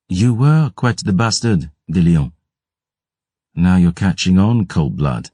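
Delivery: a sly, sneering aristocrat needling his guest; Jean-François's mocking voice.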